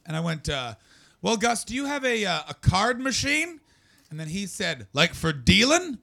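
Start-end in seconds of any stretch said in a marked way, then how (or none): 1.2-3.6 eager caller. Retold or imitated. Caller's polite question. 4.9-6.0 puzzled farmer. Retold or imitated.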